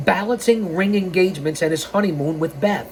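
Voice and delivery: ordinary talk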